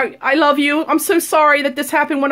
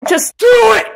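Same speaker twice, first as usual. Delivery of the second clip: shout; 3 semitones higher, 4 dB louder, slower than usual. Acted. Yelling encouragement.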